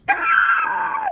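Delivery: high sharp shout; mock startled yell; sudden yelp. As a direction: jolt out a startled "Ah!". Yelling at dog in truck.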